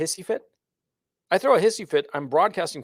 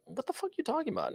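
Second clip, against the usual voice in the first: high, fast, quiet scoffing dismissal; dismissive brush-off; 11 semitones higher, 10 dB quieter, faster than usual. Pretend. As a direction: brush off the jab as nonsense. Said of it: "to go like".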